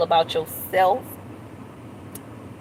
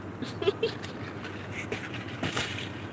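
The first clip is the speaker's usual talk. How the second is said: breathy silent laugh; 13 semitones higher, 12 dB quieter than usual. Real, not acted. Laughs at her own joke.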